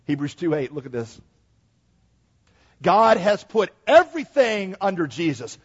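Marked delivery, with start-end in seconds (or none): 2.8-5.6 big booming preacher voice, high and loud; preacher talk. Shown as a demonstration. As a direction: proclaim it like from the pulpit. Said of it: "That's preacher talk".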